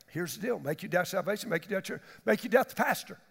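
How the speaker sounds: ordinary talk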